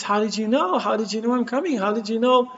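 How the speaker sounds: high-pitched retold astonished questioning; astonished mother. Mother to the son who met her train, astonished.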